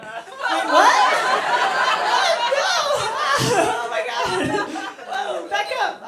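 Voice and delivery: high, loud shocked outburst; shocked shout. Retold or imitated. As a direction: yell it in sudden disbelief. Shocked reaction to mom's story.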